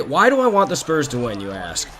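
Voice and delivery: ordinary talk, nothing marked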